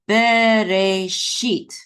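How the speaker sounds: "bereshit" very slow, each syllable held; slow syllable-by-syllable model. Shown as a demonstration. Teacher models the word for learners to repeat, drawn out. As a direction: stretch "bereshit" into three long syllables. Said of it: "Go ahead and say that".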